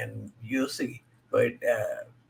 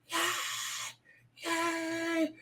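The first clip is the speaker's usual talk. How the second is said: crowd cheer; 10 dB quieter than usual. Acted.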